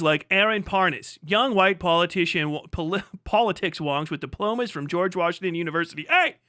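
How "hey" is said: ordinary delivery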